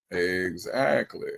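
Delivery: "Exactly." stretched very slowly; a slow, knowing "Exactly."; slow drawn-out. Acted. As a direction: drag out "Exactly." for effect. Agreeing, then repeats it drawn out.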